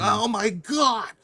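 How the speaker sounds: high, loud, slow nerdy gasp of awe; nerd voice. Acted. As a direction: squeal it like an overexcited nerd. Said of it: "I like your nerd voice you did there".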